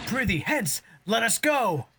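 hammed-up cry of alarm; hammy stage actor. Hero flees stormtroopers, panicked.

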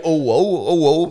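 loud, high mock gasp of a riled fella; mocking whoa. Mates mocking a jealous boyfriend's reply, hammed-up loud whoa. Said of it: "what he write?".

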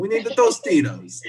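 mock Italian-American accent. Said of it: "we're talking in the Italian accent".

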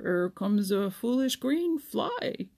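slow, teasing frog voice; sly old frog. A narrator's reading. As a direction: drop the hint slowly and slyly. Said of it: "Grandfather Frog teasing slyly".

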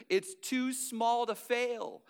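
small stretched, slow and emphatic; a slow, deliberate punchline. Shown as a demonstration. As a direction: land it slowly, leaning on small.

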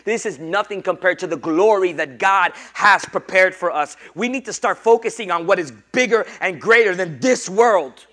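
loud, high preaching to the congregation; full-force preaching. Acted. Pastor preaching loudly to the church about worldly love.

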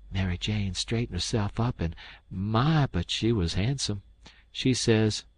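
ordinary delivery, nothing marked

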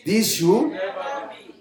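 loud, emphatic preaching read; scripture reading. Acted. Preacher reads James to the congregation, forceful. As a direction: preach the verses at full force.

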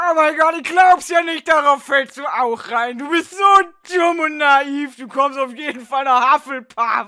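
very loud, hammed-up scorn; bellowing, exasperated ridicule; mocking shout. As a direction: yell in disbelief at someone so gullible. Sorting hat mocks gullible girl.

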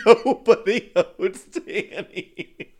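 slow, loud, high through laughter; helpless laughing delivery; laughing hard. Pretend. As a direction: cackle the words out, drawn out. Laughing mock song ending.

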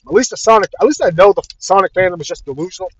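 ordinary talk

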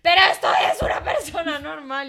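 loud high shout of a normal voice. Retold or imitated. Answering her boyfriend with a real shout. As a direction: yell it as if it were normal. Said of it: "Y yo soy como".

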